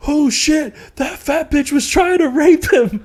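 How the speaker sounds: high, slow mock alarm; hammed-up panicked drunk; alarmed drunk friend. Drunk friend panicking about his roommate, high and slow.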